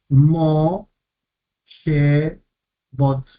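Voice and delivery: "Mo, she, bot" con pausa tras cada sílaba; separado en sílabas. Shown as a demonstration. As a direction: marca las tres sílabas de "Mo, she, bot". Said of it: "con su propia sílaba". ("Mo, she, bot" sílaba por sílaba, como profesor)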